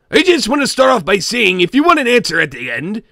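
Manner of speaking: ordinary delivery, nothing marked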